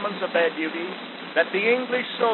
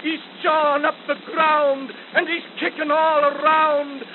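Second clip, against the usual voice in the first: rising urgent plea; 8 semitones higher than usual. Acted. Wounded soldier begging for water, building urgency. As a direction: build to a desperate cry for water.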